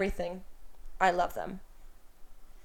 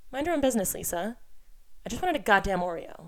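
ordinary delivery

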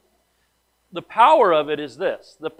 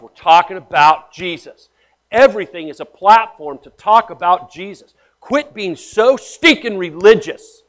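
louder, slower, driving the point home; full-force preaching; 3 semitones higher, 10 dB louder than usual. Real, not acted. Pastor urging the church to drop religiosity, preaching loud. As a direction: preach it hard at the crowd.

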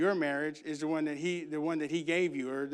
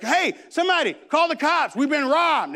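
high, loud cry for help; hammed-up yell of alarm; mock alarmed shout; 10 semitones higher, 7 dB louder than usual. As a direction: holler to the whole house in mock panic. Retold calling out in mock alarm.

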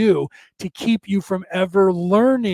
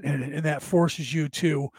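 ordinary talk, nothing marked

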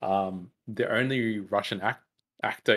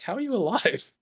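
high-pitched incredulous question; acted disbelief; 13 semitones higher than usual. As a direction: question it in high, mock bafflement. Baffled reaction to unbelievable survival.

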